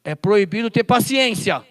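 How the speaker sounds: "É proibido ter paciência" said loud at ordinary pitch, falling on "paciência"; loud mock decree at normal pitch; loud declaration. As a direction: state the mock ban firmly. First take of mock ban.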